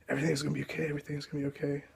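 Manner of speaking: hushed comfort for someone scared; soft reassurance. Retold or imitated.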